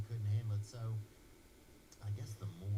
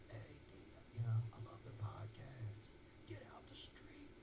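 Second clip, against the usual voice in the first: mock New Yorker; 7 dB quieter than usual. New Yorkers yelling at him.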